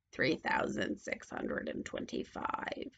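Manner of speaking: very low, soft witch; witch counting gold. A narrator's reading.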